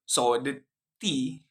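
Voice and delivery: normal talking voice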